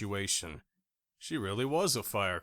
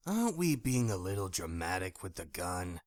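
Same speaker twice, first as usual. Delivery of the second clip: smug ex; 3 semitones lower, 5 dB quieter than usual. A narrator's reading. Drake mocking her gun.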